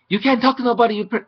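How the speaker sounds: boy yells at her, retold in a loud mock yell; imitating a shout. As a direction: bark it like the angry boy. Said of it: "Just shouted at me".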